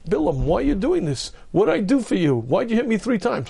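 high, plaintive donkey voice; hammed-up pleading donkey; talking donkey. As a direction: play the hurt donkey pleading. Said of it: "the donkey said".

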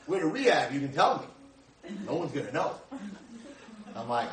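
ordinary talk

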